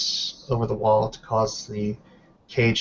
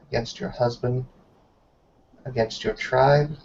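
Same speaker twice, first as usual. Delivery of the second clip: a lightly voiced elder reading a formal verdict, weighty and ceremonial; solemn sentencing. Helga pronouncing Bettina unworthy of her blood, solemn and ceremonial. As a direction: pronounce the judgment like an ancient rite.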